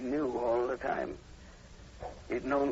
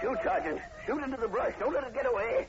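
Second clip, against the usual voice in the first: urgent; 12 semitones higher than usual. Acted. Professor Taylor to the sergeant, urging him to shoot, urgent. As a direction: drive him to fire now.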